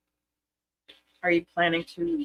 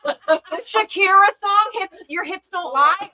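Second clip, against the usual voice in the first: excited; 12 semitones higher, 8 dB louder than usual. Real, not acted. Quoting song title, laughing.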